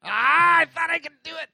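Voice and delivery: high, loud frustrated cry; frustrated shout. Real, not acted. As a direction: yell it as you fail. Failing a jump in game.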